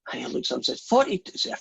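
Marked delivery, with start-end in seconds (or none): 0.8-1.3 "Forty-two?" jumps much higher in disbelief; can't believe the number; retold shocked squeak; astonished. Saint Peter astonished at the count.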